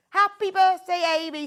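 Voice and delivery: loud, high celebratory call; excited birthday greeting. Real, not acted. Presenter wishing the kit designer happy birthday on air, excited.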